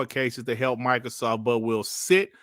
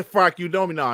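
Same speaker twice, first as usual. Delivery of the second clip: ordinary talk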